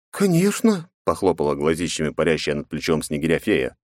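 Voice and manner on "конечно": tiny high fairy voice; pixie. A narrator's reading. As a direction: chirp it like a fairy. Fairy Kat answers.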